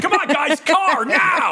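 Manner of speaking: impatient parent yelling high; shouting dad. Retold or imitated. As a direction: bark it like a fed-up dad.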